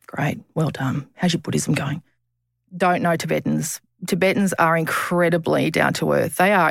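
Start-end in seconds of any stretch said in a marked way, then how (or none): 0.1-2.0 sarcastic. Retold or imitated. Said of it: "people say to me all the time online".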